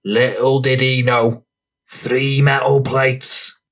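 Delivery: slow, relished street-tale delivery; rowdy storyteller. Acted. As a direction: draw each phrase out for the audience. Voices Franny telling his street tale.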